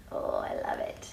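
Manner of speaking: ordinary delivery, nothing marked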